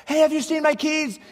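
high, loud, accusing call up the stairs; calling up to wife, mock accusatory. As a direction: blame her with the question. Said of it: "kind of accusatory tone".

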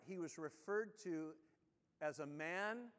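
normal talking voice

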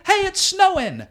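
high, bright call; playful announcement. Retold or imitated. Comic tossing popcorn into the leaf blower, high playful call. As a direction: announce it like a fun surprise. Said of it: "And I say".

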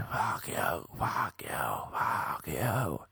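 imitating a whispered, hissing outburst; hushed cursing. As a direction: whisper the curse over and over. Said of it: "like, quietly".

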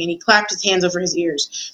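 ordinary delivery, nothing marked

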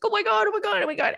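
high, frantic repeated oh my God; hammed-up panicked squeal; mock panic. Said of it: "the way I was panicking".

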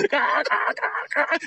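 very high cawing with laughter in it; crows cawing. Shown as a demonstration. Crows spot two hawks up high, very high frantic caws. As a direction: squawk frantically, half cracking up. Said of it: "they said".

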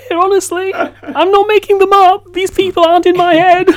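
high, giggly disbelief through laughter; real laughter, words squeaked high; laughing. Real, not acted. Host joking guests aren't imaginary.

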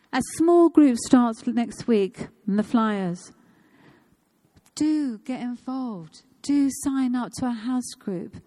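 loud, slow preacher's appeal; rallying church announcement, punched out loudly; emphatic preaching. Real, not acted. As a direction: project it to the whole congregation, urging them in.

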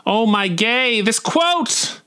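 high, squealed excitement; gushing delight. Acted. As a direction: squeal it like an overjoyed fan. Narrator gushes over the quote.

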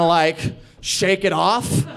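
usual speaking voice

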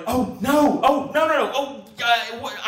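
high, loud mock panic; realising the bag is in the car, panicked; inner panic. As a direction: spiral into a rising panic. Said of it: "I'm thinking in my head, I'm going".

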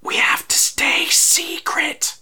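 high, slow whispered bird voice; breathy birdlike whisper, secretive; chirping bird person. Acted. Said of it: "Shimshackle is going".

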